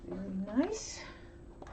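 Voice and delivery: soft whispered praise; whispering. Real, not acted. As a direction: whisper it to yourself. Quiet so as not to disturb.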